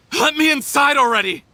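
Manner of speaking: angry demand. Acted. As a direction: shout to be let in at last. Demanding entry again.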